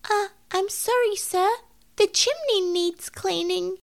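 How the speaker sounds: high, startled kitten voice; a frightened kitten stammering an apology; shocked. A narrator's reading. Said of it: "said the shocked Tom Kitten".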